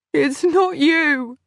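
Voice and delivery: sobbing. A narrator's reading. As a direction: push it out between sobs. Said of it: "I sobbed".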